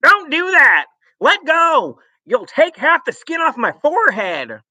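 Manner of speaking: high, loud pained protest; a father yelling at his wife to let go; panicked yelling. Acted. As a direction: shout it while the hat is yanked. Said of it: "let out a yell".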